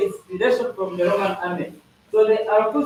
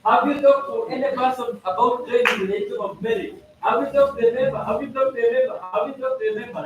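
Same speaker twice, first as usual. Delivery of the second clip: the same question again and again, faster each time; repeated question, speeding up; 3 semitones higher than usual. Shown as a demonstration. President asking the members, faster each time. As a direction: press the question, quicker each round. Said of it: "the president should ask the members".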